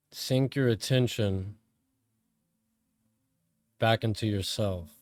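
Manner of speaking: calm, measured meditation voice with long pauses; guided meditation. Real, not acted. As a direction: guide them inward, phrase by phrase, letting each one settle.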